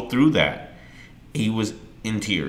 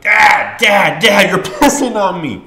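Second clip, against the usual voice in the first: shouted much higher and louder, with a laugh breaking in; panicked shout at dad; 12 semitones higher, 11 dB louder, slower than usual. Retold or imitated. Son yelling at his father who pees on him, shouting and laughing. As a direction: yell it in alarm, half laughing.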